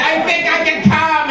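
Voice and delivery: high, strained mock urgency; strained announcement. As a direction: pitch it way up as if about to burst. Acted panicky announcement of climax.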